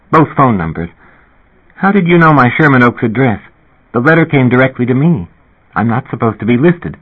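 Linda Fox. Acted. Narrator voices Linda Fox.

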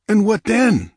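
high, loud cursing cry; angry cry. Acted. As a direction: cry out with an oath. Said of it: "cried Olgerd with an oath".

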